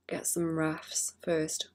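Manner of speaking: usual speaking voice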